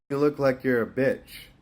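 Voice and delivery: usual speaking voice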